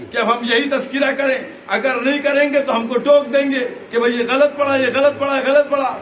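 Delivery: high, loud insistent oratory; impassioned preaching. Real, not acted. Preacher arguing his point to the majlis, full-force preaching. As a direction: drive each parallel clause home at full voice.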